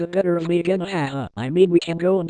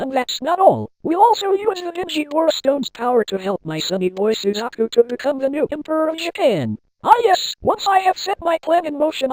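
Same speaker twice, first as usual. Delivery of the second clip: excited boasting; 7 semitones higher than usual. Acted.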